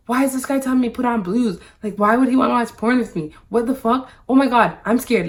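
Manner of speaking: high, loud, rapid-fire panicked retelling; freaking out. Retold or imitated. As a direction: race through the questions in rising alarm. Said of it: "freaking out inside".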